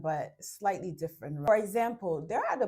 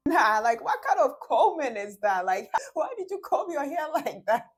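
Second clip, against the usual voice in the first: much louder and higher, laughing jeer; mocking laugh; 8 semitones higher, 8 dB louder than usual. Woman laughing loudly at her man's hair. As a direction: laugh at him loudly in front of people. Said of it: "And then she says".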